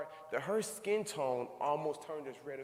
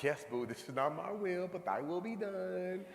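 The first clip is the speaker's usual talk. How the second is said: mock pious husband; 6 semitones higher than usual. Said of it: "I'm like".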